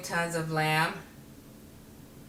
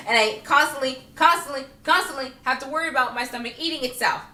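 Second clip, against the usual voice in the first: constantly repeated higher and louder; constantly punched louder; stress on constantly; 5 semitones higher, 5 dB louder than usual. Real, not acted. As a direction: hammer constantly each time.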